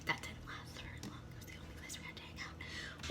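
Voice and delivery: soft confiding whisper; hushed admission; whispered aside. Real, not acted. Whispered aside after quote.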